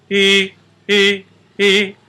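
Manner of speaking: sung high, clean onsets on e; clean vocal onset. Shown as a demonstration. Voice teacher showing students a clean onset, sung high. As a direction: demonstrate balanced onsets, clean and light. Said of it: "Are the vocal folds coming together cleanly?".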